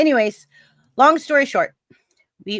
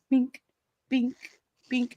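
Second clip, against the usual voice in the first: spaced, high little radar binks; imitated bink; 5 semitones higher than usual. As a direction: mimic a dot pinging on radar. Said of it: "it shows a dot".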